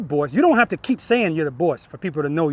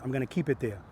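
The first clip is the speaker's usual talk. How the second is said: muttered to self; 7 semitones lower, 15 dB quieter than usual. Shown as a demonstration. Talking to herself while demonstrating.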